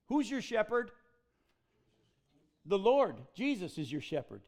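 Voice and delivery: ordinary talk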